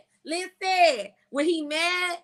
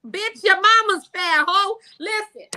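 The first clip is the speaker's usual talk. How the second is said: higher-pitched, slowed opening jab; heated clap-back; 4 semitones higher, 4 dB louder than usual. Acted. Woman clapping back at a man who called her fat, heated.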